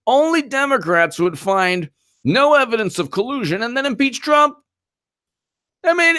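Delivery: rising, exasperated disbelief; incredulous rant. Real, not acted. Ranting about impeachment.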